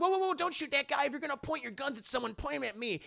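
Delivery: put-on high, urgent young hero voice; Spider-Man stepping in. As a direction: imitate a hero hurrying to intervene. Spider-Man intervenes to stop shooting.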